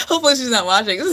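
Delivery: fast, loud, laughing words; giggly fast aside; embarrassed laughing. Real, not acted. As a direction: laugh it out in a rush. Embarrassed joke about her girlfriend.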